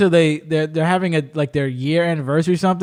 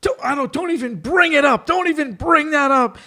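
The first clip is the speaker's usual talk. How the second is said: much higher, agitated refusal; high-pitched, worked-up plea; mock touchy protest; 9 semitones higher than usual. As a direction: imitate a son shutting down a question. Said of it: "doesn't like any kind of probing questions".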